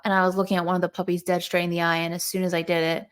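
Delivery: ordinary talk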